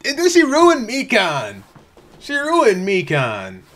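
high, slow wail of outrage; anguished complaint. Real, not acted. Fan lamenting what Junko did to Mikan, anguished. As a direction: wail it, dragging out every word. Lamenting a ruined favourite character.

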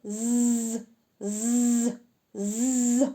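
long sustained zzz, slow and drawn out. Shown as a demonstration. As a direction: stretch each z sound out long. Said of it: "а протягнули".

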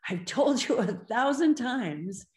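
a parent nagging a child, lightly acted; exasperated parent. Parent scolding a child yet again, mildly exasperated. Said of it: "your own voice saying".

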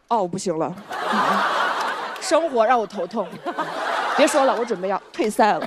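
lightly acted weary misery; mock run-down. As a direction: play a worn-out model giving up. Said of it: "你就感觉精神状态非常差". (装作精神很差、有气无力)

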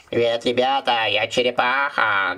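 slow cartoon turtle voice; slow creaky tortoise. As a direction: greet the kids as a slow turtle. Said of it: "говорит черепаха".

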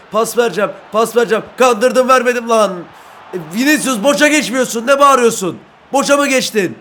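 loud, high, fired-up calls; excited yelling. Real, not acted. Gamer yelling at Vinicius during an attack, excited. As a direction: yell at the winger mid-attack.

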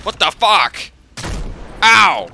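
high, loud yelling; exasperated shout. Real, not acted. Player hit by unknown damage, loud high shouting.

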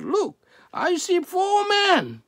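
high, loud exclamation of amazement; amazed cry. A narrator's reading. Amazed king tells his advisers what he sees, amazed cry. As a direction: cry out in astonishment, voice leaping up.

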